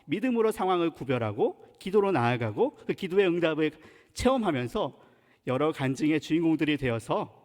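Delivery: usual speaking voice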